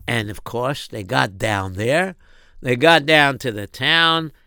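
ordinary delivery

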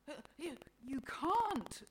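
high, loud, halting protest; appalled. A narrator's reading. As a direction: stammer in appalled disbelief. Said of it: "God looks appalled".

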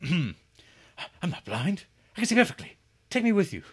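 high, loud, odd halting cadence; Donald Pleasance impression. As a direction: put on a quirky British character actor voice. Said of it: "in a voice not unlike Donald Pleasance".

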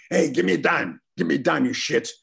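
panhandler voice. Retold or imitated. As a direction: chase them down for a dime. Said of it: "this guy going".

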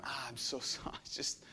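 soft, embarrassed mumble with a laugh; sheepish apology. Acted. Drowsy disciples sheepishly apologizing to Jesus. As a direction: mumble it like you've been caught napping.

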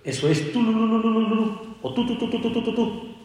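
quick broken tu-tu sounds like choked crying; rapid staccato crying imitation; imitated sobbing shofar blasts. Shown as a demonstration. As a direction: stutter the notes like someone sobbing. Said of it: "cómo llora".

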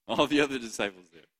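ordinary delivery

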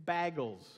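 imitated "bagels" with the vowel of bag; Minnesota pronunciation. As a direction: say "bagels" as "baggles". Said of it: "but now you say".

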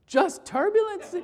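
can't believe he's so calm; incredulous teen. Retold or imitated. Frightened teen doubting the calm stranger, incredulous. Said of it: "He looked at me like I was crazy".

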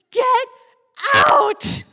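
two high, very loud blasts; acted-out furious order; screaming mom. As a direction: scream it with a break between words.

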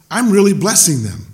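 usual speaking voice

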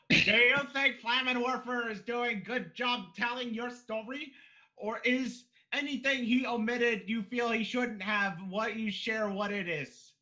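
high, flat, put-on character voice; high-pitched mock character questioning; Liler voice. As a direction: ask it in a high monotone Liler voice. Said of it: "Liler's gonna ask".

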